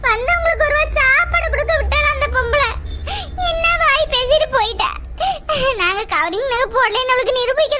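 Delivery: loud, high lament that sinks into sobs; tearful wailing. Acted. Host apologising to guests who leave unfed, wailing. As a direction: cry out in anguish, then apologise through tears.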